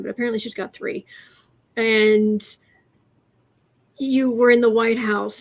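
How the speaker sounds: normal talking voice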